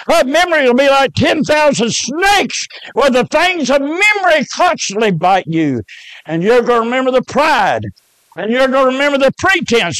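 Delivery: high, driving pulpit delivery; impassioned preaching. Acted. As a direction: preach it with rising fervor. Preaches at full force.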